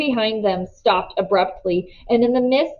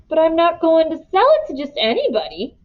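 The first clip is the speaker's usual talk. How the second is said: protesting; 8 semitones higher than usual. Acted. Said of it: "he protested".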